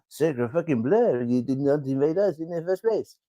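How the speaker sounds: a hammed-up bad French accent, slowing on sacre bleu; teasing the French with a bad French accent; poor French accent. Podcast host taunting French viewers in a mock French accent. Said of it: "poor French accent".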